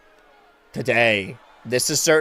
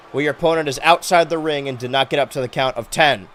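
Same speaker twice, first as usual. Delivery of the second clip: normal talking voice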